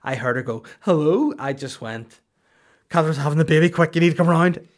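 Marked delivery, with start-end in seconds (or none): 0.8-1.3 mock high, loud phone greeting; mum answering phone. Son mimics his mum picking up the phone, loud high greeting. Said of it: "just something about the tone". 2.9-4.7 loud, raised-pitch fake emergency; mock urgent. Son prank-calls mum about the baby coming, loud mock urgency.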